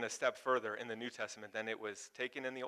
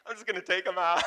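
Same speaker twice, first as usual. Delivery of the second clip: laughing retold line; 5 semitones higher, 5 dB louder than usual. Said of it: "He's like".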